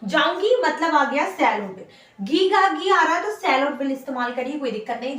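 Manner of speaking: normal talking voice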